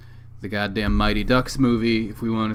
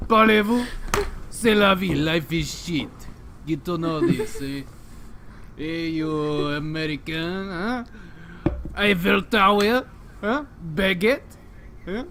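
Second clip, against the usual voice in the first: higher, hammed-up French accent, teasing an American; mock French accent; 7 semitones higher than usual. Cartoon Frenchman teasing the American host, hammed-up French accent. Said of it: "talking in a French accent".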